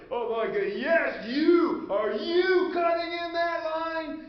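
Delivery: loud, high, slow indignant accusation; outraged shout. Retold or imitated. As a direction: shame the line-cutter in front of everyone. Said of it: "And it's like".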